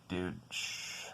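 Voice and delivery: hushed, exasperated grumble; soft, worn-down irritation; irritated, under the breath. Retold or imitated. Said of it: "getting more and more irritated".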